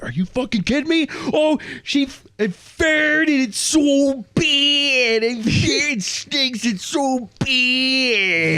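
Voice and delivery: put-on a high whining parody of her accent, slowed down; high nasal-free squeal, stretched out slow at the end; mock high whiny imitation. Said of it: "And Matt's like".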